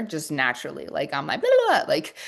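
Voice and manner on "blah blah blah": fast babble. Shown as a demonstration. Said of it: "really fast speaker".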